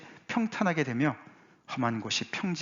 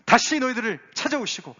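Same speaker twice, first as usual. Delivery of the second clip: high, louder proclamation; crying out; 9 semitones higher, 5 dB louder than usual. Acted. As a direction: herald the good news. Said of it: "외치는 소리가 있는데".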